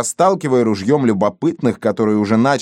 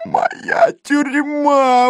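very high, drawn-out wail; anguished exclamation; much slower than usual. A narrator's reading. Police chief lamenting his ruined jail, anguished cry. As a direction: cry it out slowly, pitch soaring. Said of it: "воскликнул начальник полиции".

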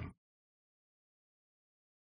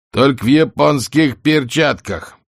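higher, slow shouted jeer; shouted heckle; 7 semitones higher, 4 dB louder, slower than usual. Acted. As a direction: heckle from the balcony. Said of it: "крикнул".

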